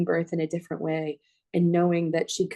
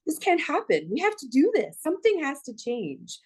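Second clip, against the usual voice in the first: determined quote; 8 semitones higher than usual. Retold or imitated. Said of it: "Rishita came to us and said".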